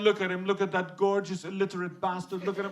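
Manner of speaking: swooning woman. Retold or imitated. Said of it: "the Australian woman's looking at him".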